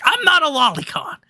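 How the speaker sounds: high, loud, whiny denial in a squeaky voice; mocking Reb. Streamer mocking Reb's defensive denial, high and whiny. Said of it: "fucking Reb was like".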